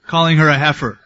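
usual speaking voice

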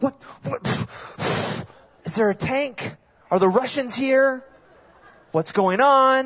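high, rising panicked questions; freaking out. Retold or imitated. Son panicking at mom's chant, high and alarmed. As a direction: act out the sudden alarm. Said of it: "I start freaking out".

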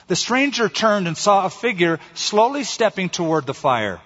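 ordinary talk, nothing marked

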